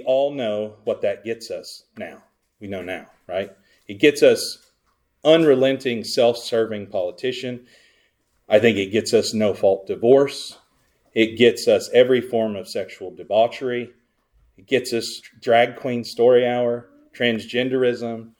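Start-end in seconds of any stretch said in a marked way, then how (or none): none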